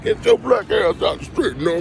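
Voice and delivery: high-pitched mocking yell at a pedestrian; mock angry driver. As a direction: squeak it out like an annoyed driver shooing someone. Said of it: "Dude looking like".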